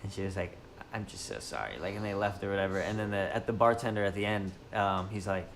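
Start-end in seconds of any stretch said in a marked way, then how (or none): 0.9-1.7 much lower and softer sorry; hushed, sunken apology in a put-on voice; social justice warrior girl. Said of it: "the voice of the social justice warrior girl".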